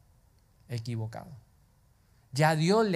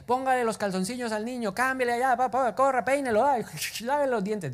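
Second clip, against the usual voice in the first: higher, hurried parent barking chores; frantic morning mom; 7 semitones higher than usual. Retold or imitated. Mother rushing the children in the morning, hurried. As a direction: hustle the kids out the door. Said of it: "no fue en ese agite".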